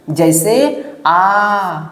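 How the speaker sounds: आ held long and slow; long vowel demo, आ drawn out slowly. Shown as a demonstration. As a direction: stretch आ out to show a long vowel. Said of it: "बड़ा वाला ई".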